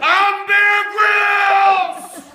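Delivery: high, loud, slow stretched yell; crazed shout. Acted. Dad landing on shore, wild crazed shout. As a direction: bellow it like a madman. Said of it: "his eyes a bit crazy".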